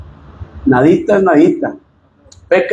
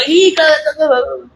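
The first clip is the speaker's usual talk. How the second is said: mock angry curse; 8 semitones higher, slower than usual. Mocking husband cursing his wife.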